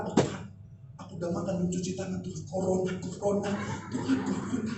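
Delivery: very soft, anxious mock prayer; hushed panic. Worrier praying about corona after eating, hushed mock panic. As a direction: fret under your breath to God.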